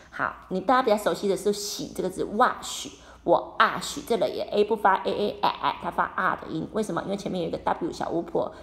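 ordinary delivery

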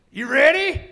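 high, loud pumped question; hyped up. Retold or imitated. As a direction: get him amped for trouble. Hyped guy asks.

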